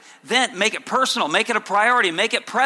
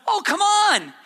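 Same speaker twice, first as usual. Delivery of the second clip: mock sarcastic prophet; 13 semitones higher, slower than usual. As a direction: taunt the people with fake encouragement. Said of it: "Amos is employing holy sarcasm".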